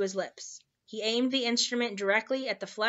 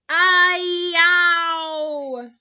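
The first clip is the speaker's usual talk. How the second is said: long, loud, high howl; big drawn-out roar, high and full; roared yowl; 8 semitones higher, 10 dB louder than usual. A narrator's reading. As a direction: let a giant's yowl rip. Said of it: "roared the flesh lump eater".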